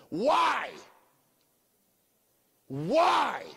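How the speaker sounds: loud, high anguished cry; yelling at the heavens. Retold or imitated. Man yelling at the heavens out back, loud anguished cry. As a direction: hurl it up at the sky.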